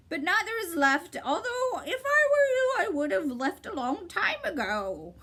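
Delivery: high, clipped bird's reply; sharp bird voice. A narrator's reading. Bird cutting Milo off, high and sharp. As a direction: snap it out like a bird.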